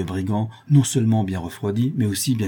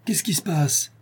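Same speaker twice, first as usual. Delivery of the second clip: higher, alarmed woman's cry from a window; 9 semitones higher than usual. Acted. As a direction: call out startled from a shutter. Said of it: "s'écria une voix de femme".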